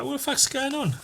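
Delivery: high, quiet squeal of bewilderment; startled bird. Acted. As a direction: play a baffled little bird, high-pitched.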